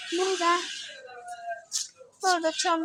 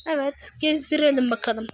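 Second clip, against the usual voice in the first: usual speaking voice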